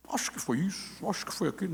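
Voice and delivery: soft, low-key musing; tentative guessing. Retold or imitated. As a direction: mull it over under your breath. Acting out his groping counseling attempts. (palpite hesitante, baixinho)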